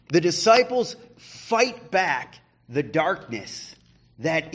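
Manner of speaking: a lift into preaching mode; rising sermon emphasis. Acted. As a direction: press the point home to the congregation.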